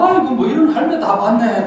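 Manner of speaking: mock grumble from the guest; grumbling visitor. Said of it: "그러고 와가지고". (가볍게 흉내 낸 투덜거림)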